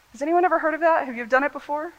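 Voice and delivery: normal talking voice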